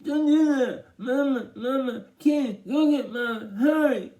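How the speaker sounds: high, loud muffled yelling; stuck-lipped frenzy. A narrator's reading. Byron frantic as Dad tugs, high muffled yelling. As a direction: yell frantically through stuck lips. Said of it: "Vi went nuts again".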